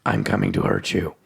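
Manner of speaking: low, gruff, menacing threat. A narrator's reading. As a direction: growl it out like a lurking monster. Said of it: "a gruff voice states".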